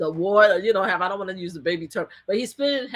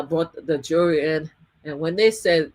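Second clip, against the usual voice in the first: usual speaking voice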